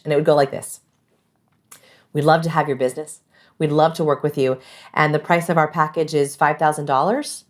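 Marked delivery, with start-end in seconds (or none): 2.1-7.4 "five thousand dollars" rising like a question; question inflection on price. Shown as a demonstration. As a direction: ask "five thousand dollars" instead of stating it, pitch climbing at the end. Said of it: "use the question inflection on their price".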